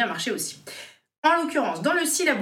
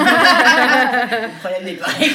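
loud laugh through the words; laughing hard at the soup question; 3 semitones higher, 10 dB louder, slower than usual. Real, not acted. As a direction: crack up while talking.

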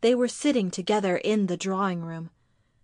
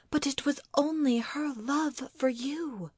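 higher-pitched, earnest defence; pleading wife; 6 semitones higher than usual. Acted. Wife defends mother to husband, pleading.

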